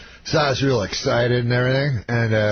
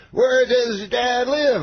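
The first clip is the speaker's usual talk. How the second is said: high, slow, stretched-out question; Bill Cosby; 12 semitones higher, slower than usual. Retold or imitated. Star questions opener about his dad, mimicked high and slow. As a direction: mimic the star, pitched way up and drawling. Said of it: "He goes".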